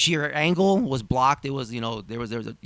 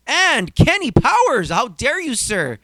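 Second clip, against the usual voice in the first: mock outrage; 5 semitones higher, 5 dB louder than usual.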